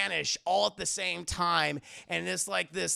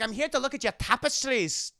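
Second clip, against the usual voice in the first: lightly hammed Scottish lord accent; muddled Scottish accent; 4 semitones higher than usual. Indy fooling the butler as Scottish lord, muddled Scottish accent. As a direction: put on a wobbly Scottish burr. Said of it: "he sounds Scottish, German, and, like, Spanish".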